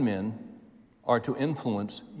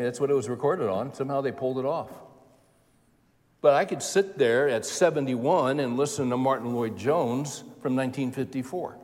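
normal talking voice

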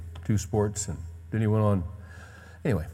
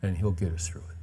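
hushed reassurance, fading to a whisper; tender; 4 semitones lower, 4 dB quieter, faster than usual. Retold or imitated. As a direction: whisper comfort to a son. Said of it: "It was so tender".

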